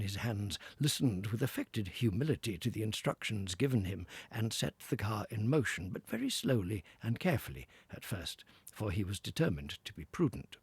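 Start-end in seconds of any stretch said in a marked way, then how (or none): none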